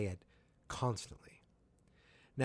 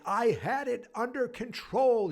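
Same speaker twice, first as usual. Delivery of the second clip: high, loud, slow mock outrage; exasperated protest; 12 semitones higher, 5 dB louder than usual. Murray protesting to Hopper after the shooting, exasperated. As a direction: drag out the complaint, pitched way up.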